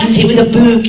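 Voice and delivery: put-on northern English accent. Quoting locals he loves hearing, northern English accent. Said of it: "I love hearing people say "book".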